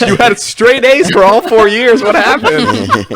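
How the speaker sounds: hammed-up baffled parent; mock incredulous parent. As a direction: wail it in comic outrage, stretching the words. Mocking parents' disbelief at fake grades.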